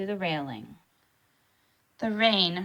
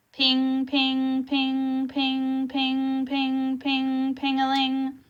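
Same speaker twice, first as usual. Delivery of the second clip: bright high pinging clock chimes; chiming clock pings; 6 semitones higher, much slower than usual. A narrator's reading. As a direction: ring out each ping like a mantel clock.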